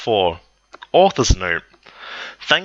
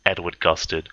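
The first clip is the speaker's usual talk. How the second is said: plain reading voice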